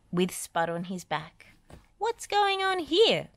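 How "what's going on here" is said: very high, slow curious call; high tractor voice. A narrator's reading. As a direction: call out puzzled. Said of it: "called Travis".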